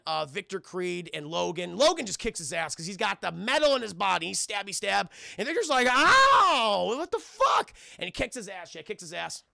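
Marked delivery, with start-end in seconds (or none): 5.9-7.7 high, loud mock yelp of pain; hammed-up pained cry. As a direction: squeal it like a cartoon villain in pain. Victor getting hurt.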